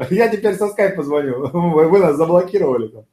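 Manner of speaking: high, giggly, bright call; laughing cheery caller. Shown as a demonstration. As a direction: chuckle while pitching. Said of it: "Яркая улыбка". (со смехом, ярко улыбаясь)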